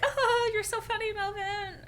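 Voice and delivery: high-pitched forced laugh into the line; fake laughing. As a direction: laugh it out falsely, like covering up. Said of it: "fake laughs to be like".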